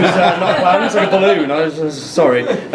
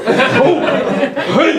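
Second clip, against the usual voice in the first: Al Pacino hoi; 7 semitones higher than usual. Retold or imitated. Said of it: "it sounds like Al Pacino".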